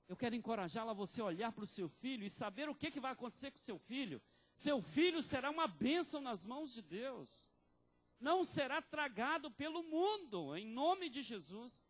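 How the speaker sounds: loud, high preaching climax; full-force pulpit declaration; fiery preaching. Acted. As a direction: proclaim it to the whole church.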